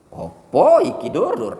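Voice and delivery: high, loud, slow Javanese teasing; Javanese accent, hammed up. Shown as a demonstration. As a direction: tease in a big Javanese voice. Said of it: "logat dia seperti itu kan ada ekspresi". (logat Jawa dilebih-lebihkan, keras)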